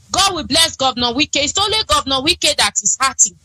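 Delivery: usual speaking voice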